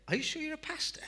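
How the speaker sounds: high, laughing mock question; teasing laugh. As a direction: tease him through a laugh. Woman teasing the doubting pastor.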